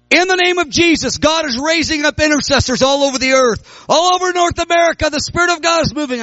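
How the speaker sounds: full-force revival declaration; prophetic preaching. Retold or imitated. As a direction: proclaim it like a prophet. Prophesying before twenty thousand people.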